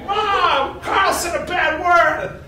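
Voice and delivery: put-on high, loud kid's tattle; tattling sister. Little sister running to tell Mom on her brother, high tattling yell.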